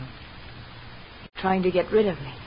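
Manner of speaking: soft and slow, each word dragged out; slow hurt accusation. Acted. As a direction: let the accusation sink in quietly, hurt. Accusing Anne, drawn out.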